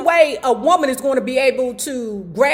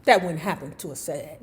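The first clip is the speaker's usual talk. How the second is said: ordinary talk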